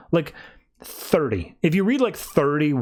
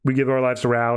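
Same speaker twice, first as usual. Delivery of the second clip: obedient group response; 2 semitones lower than usual. Retold or imitated. Followers all answering the prophets, dutiful and subdued. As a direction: recite it dutifully, without excitement. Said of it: "And then they all say".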